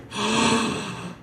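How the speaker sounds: an imitated gasp at a plot twist. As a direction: gasp like a student hitting a twist. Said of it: "I hear".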